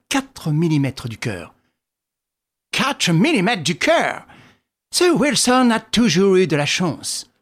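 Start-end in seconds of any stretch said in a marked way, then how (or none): 2.7-7.3 slow, high envious marvel. A narrator's reading. Sholmès hearing how close the knife came, slow envious tone. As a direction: begrudge his friend's luck. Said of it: "d'un ton d'envie".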